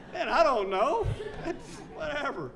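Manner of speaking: amused don't-know; laughing shrug. Retold or imitated. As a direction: toss it off with a laugh. His answer to the question.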